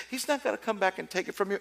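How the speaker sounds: ordinary talk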